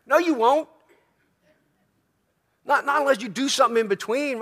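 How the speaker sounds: sharp, high and loud rebuttal; emphatic retort. Real, not acted. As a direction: cut the excuse off firmly. Preacher rebuts excuse emphatically.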